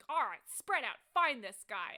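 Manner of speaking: loud, high commanding shout; rally the watchmen with a shouted order; shouted command, punched out hard; senior guard's order. Acted. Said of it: "the senior looking guy is like".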